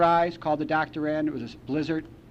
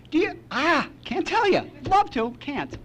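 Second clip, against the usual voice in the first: high, slow singsong tease; teasing refusal; 12 semitones higher, slower than usual. Retold or imitated. Son teasing his curious mom with a high singsong refusal. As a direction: dangle the secret playfully, pitched well up.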